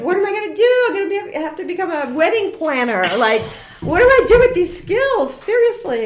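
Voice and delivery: high, loud laughing panic; mock panic. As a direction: ham up a career crisis, voice jumping up. Venting to girlfriends, panicked laugh.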